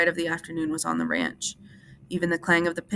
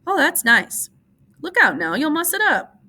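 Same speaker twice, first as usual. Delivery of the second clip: warning; 10 semitones higher, 7 dB louder than usual. A narrator's reading. Wife warns Lenny off her hair.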